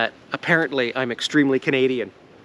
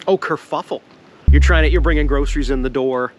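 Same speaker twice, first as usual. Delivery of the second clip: normal talking voice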